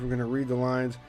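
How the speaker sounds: ordinary talk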